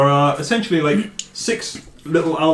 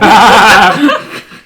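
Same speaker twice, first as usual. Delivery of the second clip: loud, high peal of laughter; loud cackle; burst of laughter; 7 semitones higher, 12 dB louder than usual. Real, not acted. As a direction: crack up laughing. Cracks up over the Welsh attempt.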